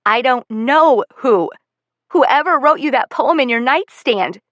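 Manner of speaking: angry shout. Acted. Daughter shouting at mother.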